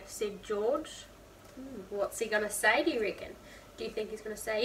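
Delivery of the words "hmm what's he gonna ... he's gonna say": plain reading voice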